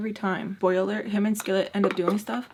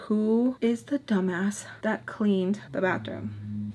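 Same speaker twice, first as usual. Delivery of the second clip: usual speaking voice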